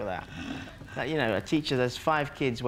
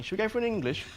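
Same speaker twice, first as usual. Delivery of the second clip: Chinese lad; 7 semitones higher, faster than usual. Retold or imitated. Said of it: "Chinese lads ... going".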